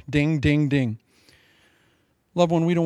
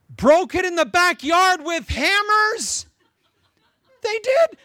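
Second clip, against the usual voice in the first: high, slow, louder disbelief; incredulous outrage; 12 semitones higher, 5 dB louder, slower than usual. Real, not acted. Preacher aghast that believers smashed the heirloom china. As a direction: cry out at the waste.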